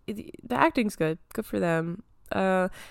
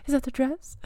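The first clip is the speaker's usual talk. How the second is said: higher, expectant question, imitated; lightly voiced hopeful asking, pitched up; imitating actress; 8 semitones higher than usual. Actress calling at each hotel door knock, imitated higher. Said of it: "she kept being like".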